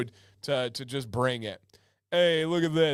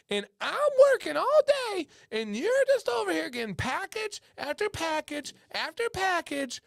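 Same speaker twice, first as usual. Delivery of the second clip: very high mock falsetto nagging; hammed-up wife telling off her husband in falsetto; scolding wife impersonation. Wife scolds husband for buying beard products, high mock falsetto. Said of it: "the wife is like".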